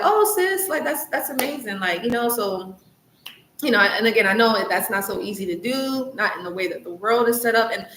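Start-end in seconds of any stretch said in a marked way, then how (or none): 0.0-1.8 higher, gushing congratulations to a friend; mock gushing praise. Imitating someone cheering on a sister friend, gushing. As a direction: squeal out delight for a friend, a touch overdone. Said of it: "or like".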